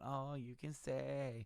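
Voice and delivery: soft, slow sing-song taunt; mock taunting. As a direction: tease him with a drawn-out jeer. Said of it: "she's, like, prancing around".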